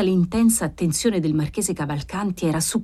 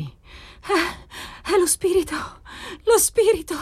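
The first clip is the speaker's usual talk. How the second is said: much higher, eerie stammer; altered, stammering voice; 10 semitones higher, slower than usual. A narrator's reading. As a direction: stammer as if seeing a spirit. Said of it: "balbettò lei, con la voce cambiata di tono". (balbettio acuto, voce alterata)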